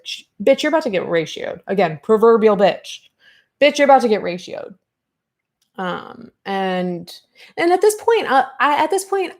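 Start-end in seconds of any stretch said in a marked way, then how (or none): 3.6-4.7 faster, louder sassy "Bitch, you're about to get ratioed"; punchy taunting repeat. Acted. 7.6-9.3 fired up. Real, not acted. Fired up outburst.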